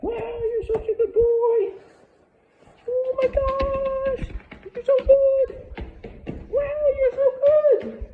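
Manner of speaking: slow, drawn-out squeal, very high and loud; over-excited praise. Shown as a demonstration. Trainer distracting the dog with praise, over-excited squeal. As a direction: gush hugely at the dog in squeals. Testing stay with gushing praise.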